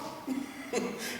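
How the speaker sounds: soft unvoiced chuckle; breathy laugh. Real, not acted. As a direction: laugh quietly at the absurdity. Laughs after mock question.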